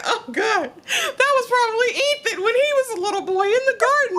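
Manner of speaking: teary laughing. Retold or imitated. Recalling emotional reaction to scene.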